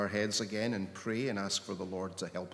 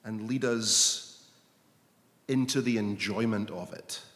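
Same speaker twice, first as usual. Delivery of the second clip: earnest prayer. Acted. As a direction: pray it, longing and unhurried. Leads congregation in prayer.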